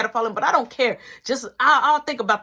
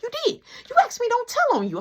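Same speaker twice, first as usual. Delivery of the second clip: little brother; 12 semitones higher, faster than usual. Retold or imitated.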